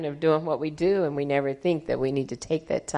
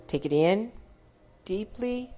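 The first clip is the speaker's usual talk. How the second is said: guided meditation; 7 semitones higher, 6 dB quieter than usual. Acted. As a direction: calmly guide each breath, unhurried. Leads a breathing meditation.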